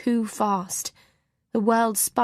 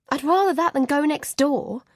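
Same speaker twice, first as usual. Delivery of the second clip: higher, sharp irritated retort; irritably; 6 semitones higher, 3 dB louder than usual. Acted. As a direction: snap back at him, annoyed. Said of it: "I say irritably".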